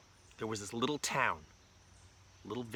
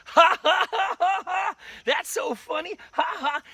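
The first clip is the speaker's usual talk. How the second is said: high, loud, hammed-up cackling; gloating laughter; 10 dB louder than usual. Daddy with magic wand laughing at his victim, gloating. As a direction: laugh through the words as a gleeful prankster.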